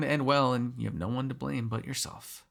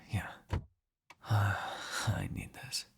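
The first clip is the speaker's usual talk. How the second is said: breathy murmur; 2 semitones lower, 4 dB quieter, much slower than usual. Acted. Murmuring beside the sleeping hunter.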